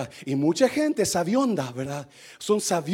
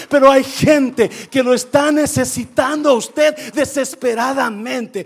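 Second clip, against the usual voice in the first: full-force preaching; 7 semitones higher, 10 dB louder than usual. Real, not acted. Preacher reading Paul's vision to the church, full-force preaching. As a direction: preach it loud and high, stretching key words.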